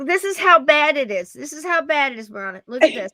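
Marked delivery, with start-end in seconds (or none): 0.1-1.3 excited call. Real, not acted. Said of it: "look, look, look".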